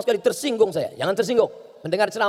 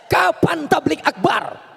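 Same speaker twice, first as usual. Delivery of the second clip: calling out; 8 semitones higher, much slower than usual. Retold or imitated. Visitor's call heard in coma.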